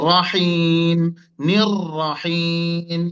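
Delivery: রহিম slowly intoned with a distinct র; clear র. Shown as a demonstration. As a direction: hold নির রহিম long, making each র clear. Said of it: "র গুলো স্পষ্ট".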